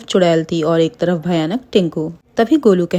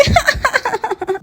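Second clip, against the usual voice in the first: very high, gleeful cackle; high-pitched storybook ha-ha-ha; witch's giggle. Acted. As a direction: squeal out a smitten witch's laugh. Witch giggles at imagined love.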